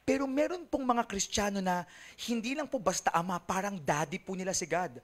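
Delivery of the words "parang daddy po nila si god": normal talking voice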